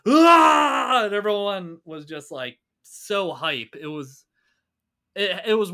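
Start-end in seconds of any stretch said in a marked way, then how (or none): 0.1-1.1 loud, high drawn-out roar; John Cena. Retold or imitated. Fan recreating a wrestler's yell for co-host, full-out roar. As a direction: let out a big wrestler's yell. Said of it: "like, John Cena did his".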